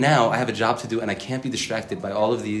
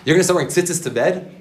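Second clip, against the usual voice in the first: high, incredulous mocking question; pitched up, taunting disbelief; doubting inner voice; 6 semitones higher, 4 dB louder than usual. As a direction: act out a scoffing inner critic. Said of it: "all these voices in my head saying".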